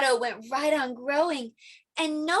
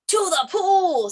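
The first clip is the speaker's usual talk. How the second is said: fireman's answer; 8 semitones higher, 5 dB louder than usual. Acted. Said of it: "said the fireman".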